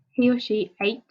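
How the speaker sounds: ordinary delivery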